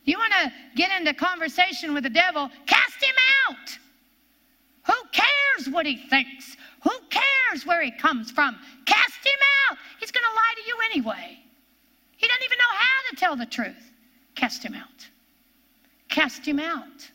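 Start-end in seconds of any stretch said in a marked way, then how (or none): 2.7-3.8 high, loud, slow rebuke; commanding shout. Acted. Preacher to congregation, shouting the command to cast out. 8.8-9.8 high, loud rebuke; commanding shout. Acted. Preacher repeating the rebuke, commanding shout. 14.4-15.1 hushed, breathless rebuke; whispered command. Acted. Preacher echoing the rebuke to the congregation, whispered.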